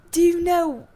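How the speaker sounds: put-on high, false start at a British accent; British accent attempt. Imitating the British flight attendant, faltering British accent. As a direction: begin the steward's British accent and lose it. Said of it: "I'm gonna mess up my accent".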